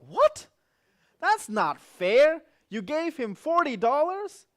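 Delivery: high, loud and slow outraged cry; a jealous brother yelling it's not fair; outraged protest. Retold or imitated. Jealous brother protesting to his parents, loud and high.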